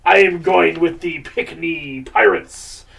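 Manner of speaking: mock pirate sportscaster. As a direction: playfully talk like a pirate, stretching the key words. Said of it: "I'm still talking in the pirate voice".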